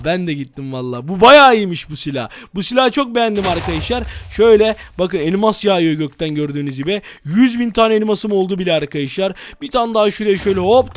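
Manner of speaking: ordinary delivery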